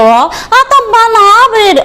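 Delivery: a hammed-up mock of a rival's taunt; mocking imitation. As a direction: parrot his words back to ridicule him. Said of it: "इनका कहना था कि".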